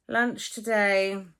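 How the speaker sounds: usual speaking voice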